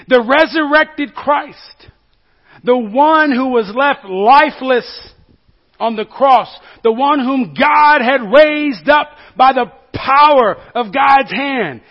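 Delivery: loud, high, slow proclamation; preaching at full force. Real, not acted. Preacher proclaiming the risen Christ to the congregation, slow and loud. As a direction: let it ring out over the congregation.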